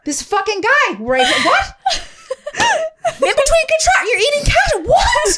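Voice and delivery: high, loud laughing disbelief; high laughing outrage; incredulous rant. Retold or imitated. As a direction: shout it up high through a laugh.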